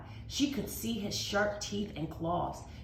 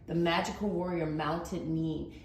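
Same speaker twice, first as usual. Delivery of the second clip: ordinary delivery, nothing marked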